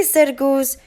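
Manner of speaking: usual speaking voice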